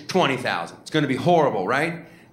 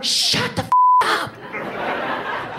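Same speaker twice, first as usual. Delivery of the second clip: hissed shush; much slower than usual. Retold or imitated. Someone hushes the rally.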